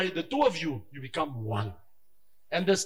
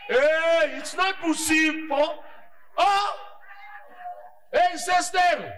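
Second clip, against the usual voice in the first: alarmed exclamation; 8 semitones higher, slower than usual. Real, not acted. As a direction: cry out a warning. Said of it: "preacher exclaims in alarm".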